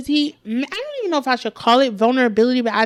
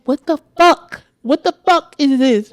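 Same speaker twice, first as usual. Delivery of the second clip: louder, baffled frustration; exasperated; 3 semitones higher, 5 dB louder than usual. Retold or imitated. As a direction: protest in loud disbelief. Acting out her own frustration.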